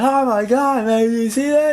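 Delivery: put-on high, excited kid voice; scared kid. The scared kid in the cinema gasping to his parents, high and excited. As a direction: squeal like a frightened child. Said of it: "when the kid got scared".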